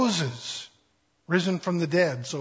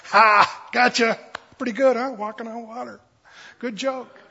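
higher, gloating little tease, lightly voiced; mock prankster Jesus, pitched up; teasing joke; 5 semitones higher, 4 dB louder than usual. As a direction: rib the disciples playfully. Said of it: "he was gonna go".

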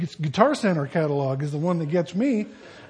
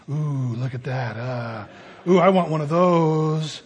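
covetous ooh; 3 semitones lower than usual. Retold or imitated. Man drooling over tool catalog.

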